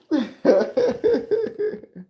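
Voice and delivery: high, loud burst of laughter; a real high laugh breaking out. Real, not acted. Laugh after Aussie riff.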